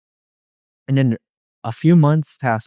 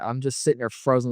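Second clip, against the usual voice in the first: ordinary delivery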